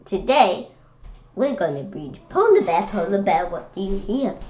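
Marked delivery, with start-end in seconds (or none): none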